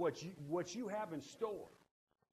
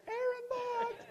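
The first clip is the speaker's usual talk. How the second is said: much higher, thin mock voice of God; squeaky God voice; slower than usual. Pastor mocking how God wouldn't sound, high squeaky call. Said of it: "I don't think he's gonna say".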